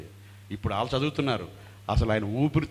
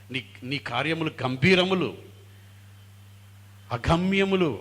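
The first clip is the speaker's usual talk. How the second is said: raised, loud voice of a psalmist; higher, ringing proclamation; extolling praise; 4 semitones higher, 7 dB louder than usual. Acted. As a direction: declaim God's works with awe. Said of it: "బాగా వర్ణిస్తుంటాడు".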